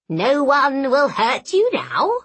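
little goblin voice, swooping up at the end; animated goblin comforting a patient; reassuring hobgoblin. A narrator's reading. Narrator voices hobgoblin Fenswick.

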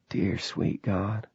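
hushed through fingers over mouth; slow muffled murmur behind a hand. A narrator's reading. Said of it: "clapped a hand to his mouth".

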